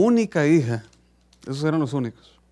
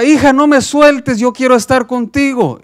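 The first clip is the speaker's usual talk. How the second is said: desperate scream; 9 semitones higher, 9 dB louder than usual. Acted. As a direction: scream for her not to let go. Padre grita suplicando. (grito suplicante, alto y fuerte)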